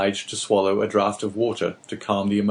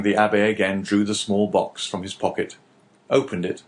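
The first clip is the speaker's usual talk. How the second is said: ordinary talk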